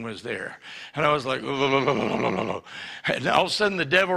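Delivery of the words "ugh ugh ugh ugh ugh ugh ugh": low shuddering ugh grunts; shaky craving groans. Retold or imitated. Teen waking with shakes, low shuddering grunts. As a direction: groan like you're shaking with craving.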